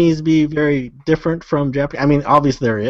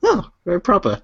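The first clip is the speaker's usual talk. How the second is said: higher, mock-proper British; mock British accent; 5 semitones higher than usual. Host approving the British take, mock British accent.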